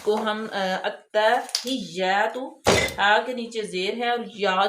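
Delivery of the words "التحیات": "التحیات" very slow, doubled تا and clear ہا; drawn-out "التحیات"; careful recitation. Shown as a demonstration. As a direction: model "التحیات" slowly. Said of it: "تا جو ہے وہ مشدد ہے".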